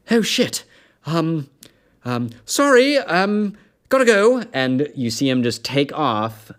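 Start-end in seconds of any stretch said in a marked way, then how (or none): 0.1-4.4 high, loud flustered yelp; the elf bolts in a panic, voice cracking high; panicked elf. Acted. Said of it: "the elf just goes".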